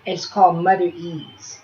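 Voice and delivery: ordinary talk, nothing marked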